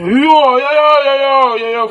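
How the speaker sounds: high, loud alarmed wails; real panic as the game slips away; frustrated gamer crying out over lag; panicked cries. Real, not acted. Panic as phone lags.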